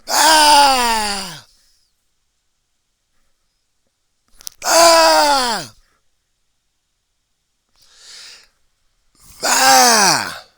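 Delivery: very high, very loud put-on screams; hammed-up furious screaming; mock heckler screams. Heckler screaming in his face, hammed-up screams.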